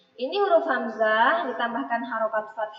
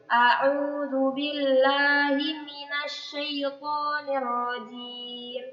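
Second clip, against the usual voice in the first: slow, drawn-out chanted recitation; teacher slowly chants the ta'awudz for children to follow; Quranic recitation; 2 semitones higher, much slower than usual. Acted. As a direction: stretch each syllable like tilawah.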